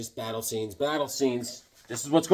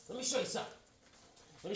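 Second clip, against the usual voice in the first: much softer, higher repeat; 7 semitones higher, 17 dB quieter, much faster than usual. Shown as a demonstration. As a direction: say it again under your breath. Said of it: "All right, but let me show you something".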